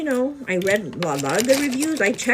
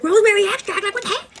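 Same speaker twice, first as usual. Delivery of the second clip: much higher, louder outraged disbelief; shocked; 13 semitones higher, 8 dB louder, faster than usual. Retold or imitated. Finding rosemary extract on her vitamin bottle, shocked squeal.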